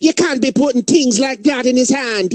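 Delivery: high-pitched scolding Jamaican nurse; Jamaican accent. Acted. Nurse objecting to beer in a patient's hand, mock Jamaican scolding. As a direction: put on a sing-song Jamaican accent. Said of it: "a Jamaican nurse that's like".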